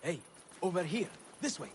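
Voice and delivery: furtive beckoning from the side; secretive, hushed call. Acted. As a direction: beckon someone over discreetly. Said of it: "Sorry for the melodramatics".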